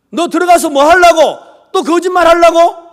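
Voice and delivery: loud, high shouted challenge; angry accusation. Retold or imitated. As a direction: act out a suspicious father snapping back. Father's accusing retort acted out.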